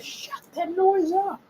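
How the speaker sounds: high mock wife who scolds and then admires the build. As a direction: play the wife yelling then melting. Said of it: "Wife will come like".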